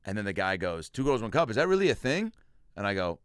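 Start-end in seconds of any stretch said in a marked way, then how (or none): 0.9-2.3 higher, rising puzzled voice; incredulous question. Retold or imitated. Audience member questions the comic, mock incredulous. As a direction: imitate a baffled audience member, pitching up.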